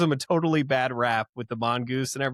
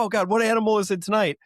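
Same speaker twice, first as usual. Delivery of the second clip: exasperated; 7 semitones higher, faster than usual. Retold or imitated. Retold bored TV viewer thought.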